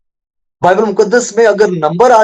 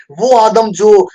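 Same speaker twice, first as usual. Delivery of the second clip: impassioned preaching; 4 semitones higher, 3 dB louder than usual. Acted. Preacher laments Adam leaving God's presence, impassioned preaching. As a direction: pitch climbing into a proclamation.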